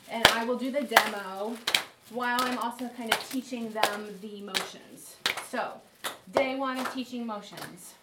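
ordinary talk

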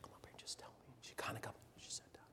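unvoiced whisper to a crying child; retold whisper. Teacher to crying pupil, gentle whisper. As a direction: hush it so only she hears.

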